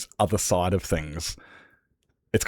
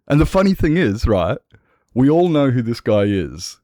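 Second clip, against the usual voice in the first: loud build-up; 4 semitones higher, 7 dB louder than usual. Real, not acted. Building up the flag reveal.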